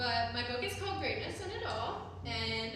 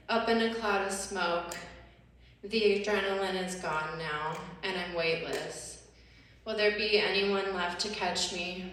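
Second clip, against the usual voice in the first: deliberate spoken-word delivery, lines falling away; measured poem reading with pauses; poetry recital; 3 semitones lower than usual. Acted. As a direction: recite it in measured, separate phrases.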